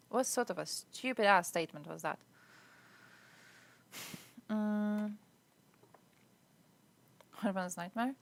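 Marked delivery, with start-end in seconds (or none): none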